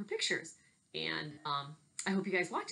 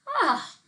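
yelp; 11 dB louder than usual. Acted. Boy falls in story.

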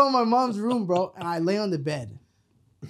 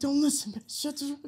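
higher, strained stage whisper; urgent hushing, imitated; 6 semitones higher, 7 dB quieter than usual. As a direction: hush her before the kids hear. Hushing wife while kids are outside.

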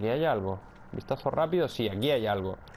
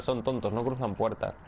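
normal talking voice

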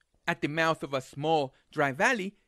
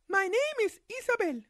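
put-on high girl's voice; little girl voice.

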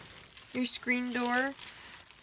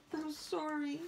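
soft apology to cat; 5 semitones higher, 9 dB quieter than usual. Real, not acted.

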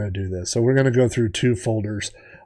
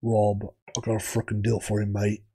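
Dean impersonation; 2 semitones lower, 7 dB quieter than usual. Retold or imitated. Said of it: "I do a good Dean impersonation".

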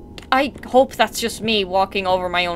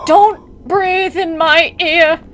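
freaked-out protest; 6 semitones higher, 6 dB louder, much slower than usual. Real, not acted. Mannequin breathing close by.

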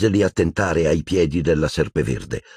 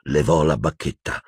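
plain reading voice

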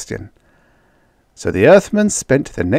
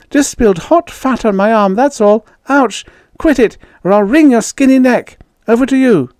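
high, loud excuse breaking into a threat; flustered babble; 6 dB louder than usual. A narrator's reading. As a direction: babble while dodging stones. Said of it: "Judy babbled".

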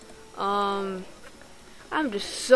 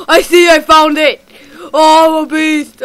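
loud gasp, then a high, loud boast; excited; 4 semitones higher, 12 dB louder than usual. Real, not acted. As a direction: gasp and brag about the find. Finds it after searching.

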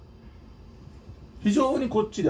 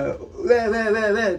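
mock distant yapping; 4 semitones higher than usual. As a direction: mimic a loser yelping わーわーわーわー from a distance. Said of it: "負け犬の遠吠えだっつうの".

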